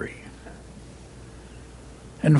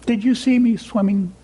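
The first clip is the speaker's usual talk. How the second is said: put-on much higher squeaky baby voice; squeaky Roo; 7 semitones higher than usual. A narrator's reading. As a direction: squeak proudly to everybody. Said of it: "squeaked Roo".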